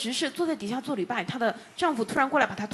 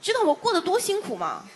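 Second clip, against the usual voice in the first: interpreted husband's grievance, higher-pitched; complaining husband; 7 semitones higher than usual. Retold or imitated. Interpreter relaying the husband's complaint, aggrieved protest. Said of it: "他先生说什么".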